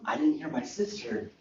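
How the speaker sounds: usual speaking voice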